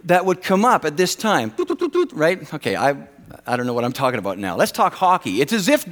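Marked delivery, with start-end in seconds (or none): 1.5-2.1 high tooting beep imitation; much higher beeping toots; mock computer beep. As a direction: play a computer chiming in, high.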